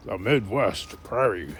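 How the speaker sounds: deep, slow, booming orator; slow Midwest farm-country boom; Abraham Lincoln voice. Shown as a demonstration. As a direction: intone it low and drawn out, like a statesman. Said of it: "Abraham Lincoln voice".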